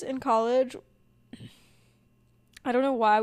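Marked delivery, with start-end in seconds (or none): none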